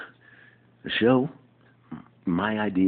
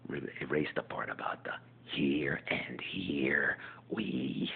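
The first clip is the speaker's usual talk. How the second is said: slow unvoiced whisper; whispered aside; 6 semitones lower, 9 dB quieter, slower than usual. Real, not acted. Whispering a reminder to erase the tape. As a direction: drag it out in a hush. Mental note to self aside.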